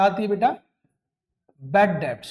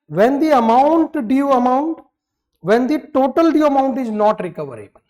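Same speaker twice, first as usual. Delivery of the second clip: slow dictation; 4 semitones higher, 6 dB louder, much slower than usual. Shown as a demonstration. Teacher dictates the definition.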